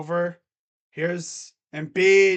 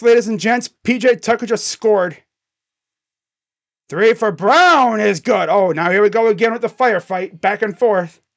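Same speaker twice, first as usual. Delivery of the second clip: louder announcer call peaking on the three; excited play-by-play; 3 semitones higher, 9 dB louder than usual. Acted. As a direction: call the big shot like a broadcaster. Streamer calls the game live.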